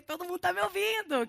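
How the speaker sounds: a touch of playful pride; mock boasting. Jesus on the mountaintop, lightly mock-proud.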